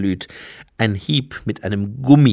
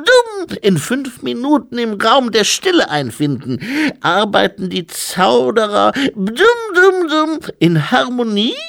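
very high, loud infant voice; squeaky toddler speechifying loudly; baby-like abbot; 5 dB louder than usual. A narrator's reading. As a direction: play a dignified abbot in a baby's piping voice.

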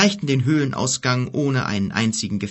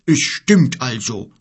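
slow bear's grumble, louder; growling bear; 2 semitones higher, 6 dB louder, slower than usual. Acted. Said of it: "brummte der Bär".

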